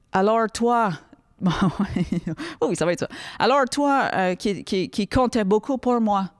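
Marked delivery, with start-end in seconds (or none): 0.1-0.9 French with an English accent; mock English-accented French. Shown as a demonstration. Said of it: "avec un accent anglais". 3.4-6.3 French in a put-on English accent; slow, hammed-up English accent. Shown as a demonstration. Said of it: "avec un accent anglais".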